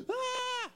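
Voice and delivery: sudden high frightened yelp; startled high gasp of fear; terrified cry. Retold or imitated. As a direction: cry out in terror at the sight. Terrified cry at seeing God.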